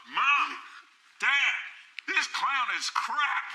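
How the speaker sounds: put-on loud, high kid yelling to parents; heckling kid. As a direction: imitate a child's shrieked complaint. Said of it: "this little eight-year-old kid".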